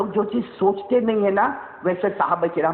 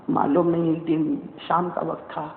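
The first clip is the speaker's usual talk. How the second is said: usual speaking voice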